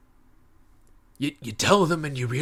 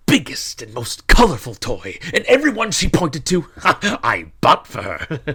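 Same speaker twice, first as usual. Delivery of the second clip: high, loud, delighted telling with laughs; overjoyed, voice lifting and breaking into laughter; joyful excitement; 5 semitones higher, 9 dB louder than usual. Acted. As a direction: burst with joy, laughing as you recall it. Joyful memory of buying every toy.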